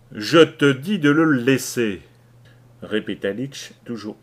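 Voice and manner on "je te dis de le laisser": loud, slow, steely command; hard, metallic voice. Acted. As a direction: repeat the order, cold and hard. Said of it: "sa voix prenait une sonorité métallique".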